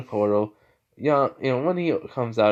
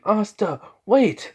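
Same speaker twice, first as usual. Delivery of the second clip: high, loud call to stop someone; 10 semitones higher, 6 dB louder, slower than usual. Acted. Mage calls Asta back at the portal, calling out. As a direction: call out urgently after someone leaving.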